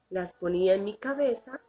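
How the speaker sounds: ordinary delivery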